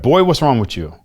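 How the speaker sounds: father confronting his son about what's wrong; stern father. Retold or imitated. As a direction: demand it like a worried stern father. Father questions him at home.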